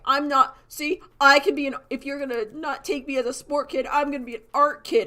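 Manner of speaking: high, loud mock-indignant voice; defiant announcement. Rejected sport kid switching to art kids, mock defiant. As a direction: announce it loudly with hammed-up defiance.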